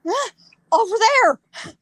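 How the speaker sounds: high, drawn-out breathless cry, acted; gasp, then squeeze the words out high; out of breath. Pointing dad toward the bar, acted breathless. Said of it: "when you're out of breath".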